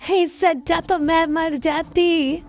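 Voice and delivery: mother lamenting her son's injury, wailing high. Acted. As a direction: cry out to God over your son's leg. Mother sees son's broken leg.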